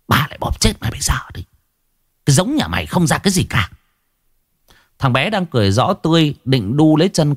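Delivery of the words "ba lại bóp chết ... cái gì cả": fast, higher-pitched angry threat; snarled threat. A narrator's reading. Possessed grandmother threatening the grandson, fast snarl. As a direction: speed up into a spiteful scolding. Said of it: "bỗng nhiên bà gằn giọng".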